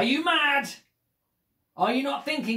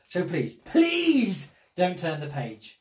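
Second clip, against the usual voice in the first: slow desperate plea; slower than usual. Acted.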